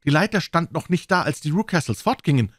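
high, eager woman's voice; young governess, excited and keen. A narrator's reading. Miss Hunter's voice continues.

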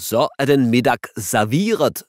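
a hint of a funny put-on chef voice; strange voice. Dad in disguise announcing dinner, funny voice. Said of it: "lyder en mærkelig stemme".

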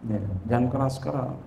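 soft, hushed admonition; softly spoken reminder. Shown as a demonstration. Preacher telling students how to address teachers and parents, softly. Models gentle speech before elders.

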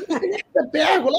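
high, fast retold protest; protesto exasperado. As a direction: protest your innocence in a quick high voice. Husband protesting to hysterical wife. (protesto agudo e apressado, encenado)

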